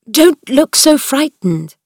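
slow, gentle, concerned reassurance; worried voice. Acted. A worried uncle calming frightened Mary, slow and gentle. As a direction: soothe a frightened child. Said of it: "in a worried voice".

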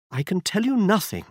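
a hint of a frightened noblewoman; Lady Hilda voiced. A narrator's reading.

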